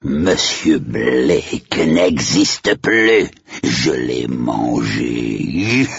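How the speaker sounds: very slow, theatrical ghoul; ghoulish, drawn-out boast; menacing hellish majordomo. Acted. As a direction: drag it out like a horror butler. Majordomo taunts the children.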